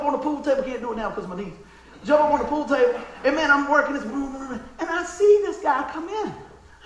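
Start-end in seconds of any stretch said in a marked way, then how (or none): none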